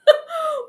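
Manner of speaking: very high burst of laughter; real high laugh at a frozen stream; high helpless laugh. Real, not acted. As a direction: crack up helplessly. Stuck on live stream.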